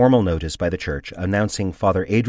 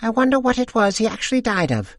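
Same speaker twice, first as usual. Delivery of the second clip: put-on much higher gossiping old woman voice; old lady; 4 dB louder than usual. A narrator's reading. Second old lady gossiping with friends, high voice. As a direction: chime in like a gossipy widow. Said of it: "chimes in".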